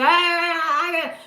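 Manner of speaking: high, loud mock screaming gibberish; mock yelling. Mocking a partner yelling on the phone, loud high gibberish. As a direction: imitate someone screaming down the phone. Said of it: "All I can hear".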